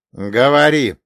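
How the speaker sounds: loud sharp order; commanding. Acted. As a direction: bark the command. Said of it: "приказал я". (резкий приказ)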